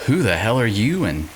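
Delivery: ordinary talk, nothing marked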